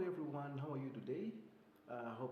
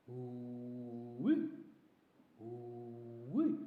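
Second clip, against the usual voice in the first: rising hooting woo-wee; hyena call; 2 semitones lower, 4 dB louder, much slower than usual. Shown as a demonstration. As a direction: whoop like a hyena. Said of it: "and a hyena".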